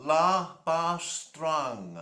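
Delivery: La Bastrangue drawn out, word by word; slow, careful pronunciation for someone writing it down; said slowly. Shown as a demonstration. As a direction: stretch each syllable of the tune title. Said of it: "Okay, I'll do it slowly".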